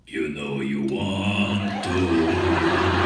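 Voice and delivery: extremely slow, booming low coaxing; Andre. Retold or imitated. Giant tempting the actor onto the bike, deep and drawn out. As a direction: stretch every word in a huge deep voice. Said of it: "he went, he goes".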